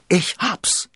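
sudden high, loud eureka; calling out. A narrator's reading. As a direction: burst out with the brilliant idea. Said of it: "rief er".

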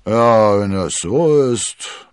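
loud, slow grumbling assent; drawn-out skipper's growl; gruff captain. A narrator's reading. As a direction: give in with a big grumble. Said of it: "brummte der Kapitän".

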